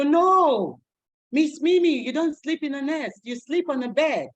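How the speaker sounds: put-on high, loud child protest; kids' voice. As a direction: insist like a little kid. Voicing kids correcting her.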